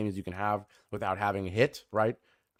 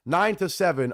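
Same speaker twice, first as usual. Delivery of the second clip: usual speaking voice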